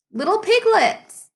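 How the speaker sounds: lightly voiced storyteller delight; playful greeting.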